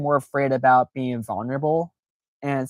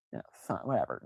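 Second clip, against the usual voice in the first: much softer, lower, dismissive; bored shrug; 5 semitones lower, 12 dB quieter, faster than usual. Retold or imitated. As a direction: brush it off like you don't care. Voicing indifferent eight.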